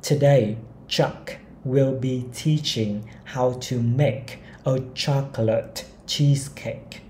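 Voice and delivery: each word of the sentence spaced out slowly; slow model reading. Shown as a demonstration. Teacher reading a model sentence to learners, very slowly. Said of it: "Tiếp theo này".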